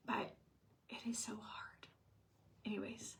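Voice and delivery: soft unvoiced whisper; whispered aside. Real, not acted. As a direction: drop to a confiding whisper. Aside after talk of learning Spanish.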